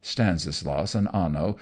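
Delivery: ordinary delivery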